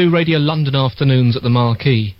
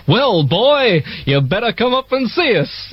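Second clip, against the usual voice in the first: high, brash American drawl as the caller; imitating a Yank from the movies; older American voice on the phone inviting him up; American accent; 9 semitones higher, slower than usual. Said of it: "They're American".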